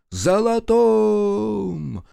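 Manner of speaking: high, long-held chanted cry; crowd response. A narrator's reading. Crowd echoes the leader, high drawn-out cry. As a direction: hold the word high like a crowd. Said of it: "гаркнула в торе ему толпа".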